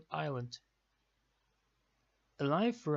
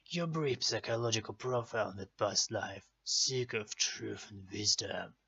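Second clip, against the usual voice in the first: deep ominous fortune teller; horror host; 4 semitones lower than usual. Acted. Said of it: "fun Celtic stuff".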